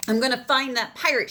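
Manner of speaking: loud determined shout. Acted. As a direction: vow it out loud. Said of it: "shouted Maggie".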